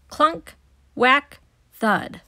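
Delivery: loud thumping sound effects; building noises. Acted. Team builds the playground, loud clunks and thuds. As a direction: hit each noise like a hammer.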